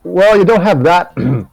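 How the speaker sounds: put-on reassuring boy. Dante reassures.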